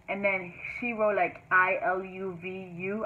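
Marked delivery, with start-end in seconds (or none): 1.5-3.1 slow, letter by letter; deliberately spelled out. Retold or imitated. Reading mom's text to her boyfriend, slow letter-by-letter readout. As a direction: read out each letter separately and slowly. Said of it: "I read it like that on purpose".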